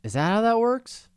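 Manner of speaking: surprised. Real, not acted. Surprised question.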